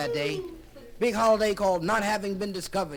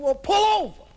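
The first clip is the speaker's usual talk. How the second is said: much higher, much louder demand; excited order; 12 semitones higher, 9 dB louder than usual. Acted. Chris telling the crew to stop, loud. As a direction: shout it like a backseat driver. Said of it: "Chris said".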